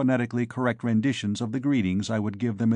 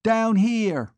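much higher, loud call; yelling; 10 semitones higher, 4 dB louder than usual. A narrator's reading. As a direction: yell the direction. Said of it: "she yelled".

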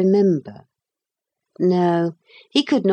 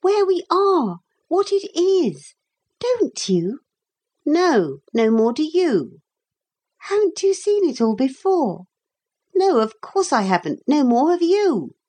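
put-on high-pitched children trading retorts; high, insistent child voices; bickering children; 10 semitones higher than usual. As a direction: voice two children squabbling. Narrator voices two children bickering.

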